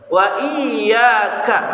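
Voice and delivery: slow, drawn-out Wa iyyaka with the ain from mid-throat. Shown as a demonstration. Teacher showing the class the throat sound. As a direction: stretch out iyyaka slowly from the throat. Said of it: "di sini tengah kerongkongan".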